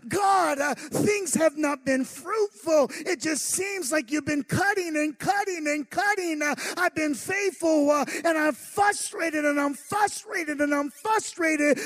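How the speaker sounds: hammed-up frustrated pleading; frustrated prayer. Voicing a frustrated believer's prayer.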